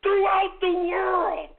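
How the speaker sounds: pitched high, slow and loud; drawn-out high shout. Acted. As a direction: stretch throughout the world up high like a proclamation. Host boasting of his audience.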